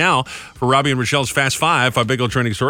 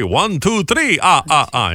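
a hint of the Count, counting with a higher lilt; Count impression; 6 semitones higher, slower than usual. Host counting for his cohost as the Count. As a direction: count it out like the Sesame Street vampire. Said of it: "the Count from Sesame Street".

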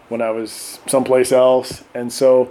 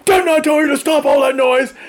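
Marine Corps shout; 12 semitones higher than usual. Retold or imitated. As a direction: shout it down the hall like a sergeant. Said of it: "I'm using my Marine Corps voice".

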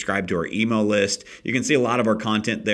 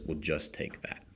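lower, much softer retold advice; soft reassurance; 3 semitones lower, 6 dB quieter than usual. Horse advises the lost boy to take one step, soft reassurance. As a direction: ease the voice down, a retold gentle counsel.

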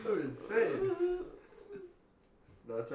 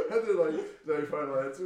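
laughing; 5 semitones higher, 11 dB louder, faster than usual. Real, not acted. Laughing through game show quote.

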